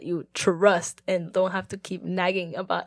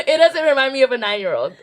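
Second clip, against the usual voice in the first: laughing; 4 semitones higher, 7 dB louder, faster than usual. Real, not acted. Laughing about the name.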